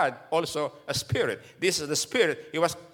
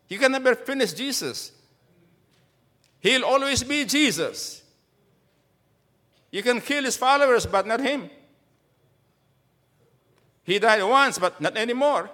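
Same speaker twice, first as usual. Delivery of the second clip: raised, high fervent preaching; 8 semitones higher, 5 dB louder than usual. Acted. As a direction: proclaim it to the congregation. Preaching at full force.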